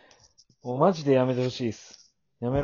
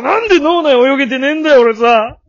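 loud, higher rant that slows at the end; mock frustrated shout; 8 semitones higher, 10 dB louder than usual. Ranting at himself for not reading his partner's mind, loud mock frustration. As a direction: yell it at yourself in comic exasperation.

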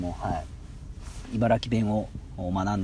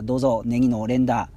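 ordinary talk, nothing marked

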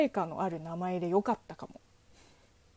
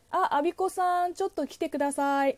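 higher-pitched office call to a coworker; calling a colleague; 7 semitones higher than usual. Acted.

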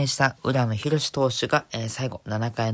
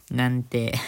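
ordinary talk

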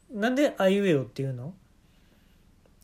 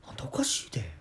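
whispered jab; 5 semitones lower, 7 dB quieter, faster than usual. Acted. Muttered aside after rant.

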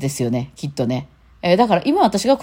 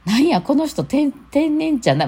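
surprised realization; 11 semitones higher than usual. Retold or imitated. Realizing he is a natural airhead.

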